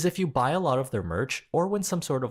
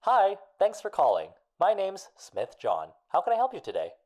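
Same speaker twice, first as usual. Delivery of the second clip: higher, polite fake-friendly phone voice; customer service voice; 7 semitones higher than usual. Shown as a demonstration. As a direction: sound like a call centre rep. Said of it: "that fake customer service voice".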